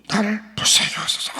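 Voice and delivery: a desperate beggar crying out over the crowd. Retold or imitated. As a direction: push the plea up high and fast. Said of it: "더 크게 소리 질러".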